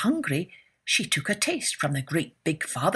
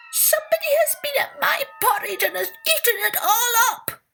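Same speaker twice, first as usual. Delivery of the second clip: put-on much higher, crying baby bear; wailing little bear, very high; 4 dB louder, faster than usual. A narrator's reading. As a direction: squeal it like a tiny upset cub. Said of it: "in his bitty baby bear's voice".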